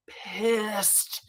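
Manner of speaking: "pissed" stretched out long; slow, lingering stress on "pissed"; drawn-out emphasis. Real, not acted. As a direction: drag out the word "pissed" for effect. Drawn-out emphasis on her anger.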